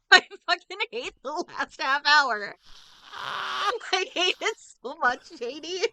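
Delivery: high-pitched laughing rant; laughing exasperation. Real, not acted. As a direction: blurt it out through real, helpless laughter. Laughing exasperation after nearly crying.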